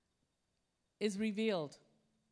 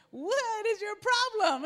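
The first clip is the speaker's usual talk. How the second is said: higher, louder, slow baffled driver; annoyed driver; 9 semitones higher, 9 dB louder, slower than usual. Retold or imitated. Driver glaring at impatient passenger, high slow annoyed.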